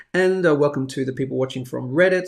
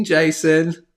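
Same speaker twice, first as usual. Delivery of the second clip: ordinary talk, nothing marked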